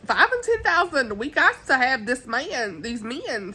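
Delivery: high, swooping mock-fretful mother; high-pitched Mrs. Bennet. Vlogger mimicking mother eyeing rich suitors, high-pitched. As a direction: imitate a mother excited over rich suitors. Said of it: "her high pitch, she's like".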